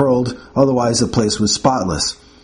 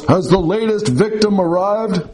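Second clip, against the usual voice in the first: put-on grumpy old patient; 7 semitones higher than usual. Patient calling from inside.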